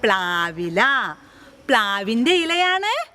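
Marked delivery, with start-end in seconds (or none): 0.0-0.8 slow clear model answer. Shown as a demonstration. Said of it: "ഇത് ഏത് ഇലയാണ്?".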